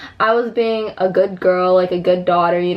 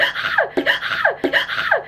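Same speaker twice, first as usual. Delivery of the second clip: high, half-laughing shrieks at a buzz; freaked-out shrieks; slower than usual. Retold or imitated. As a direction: shriek at an imagined bee. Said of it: "I would start freaking out".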